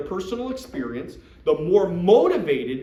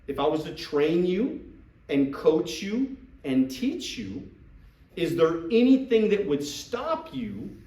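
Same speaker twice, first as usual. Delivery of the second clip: measured phrases with weighted pauses; slow, deliberate pitch. Shown as a demonstration. Recruiter asking a prospect to give it a try, slow and deliberate. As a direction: spell it out slowly, phrase by phrase. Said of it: "you see the way that I'm talking?".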